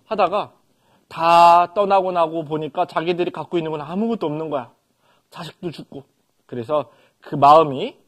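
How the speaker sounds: normal talking voice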